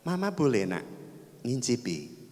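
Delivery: soft, low motherly request; quietly ask, as a mother to her child; lightly voiced mother. Mother asks her child for a taste of apple, lightly voiced coaxing. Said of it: "ibunya bilang sama anaknya".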